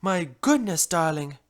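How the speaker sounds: plain reading voice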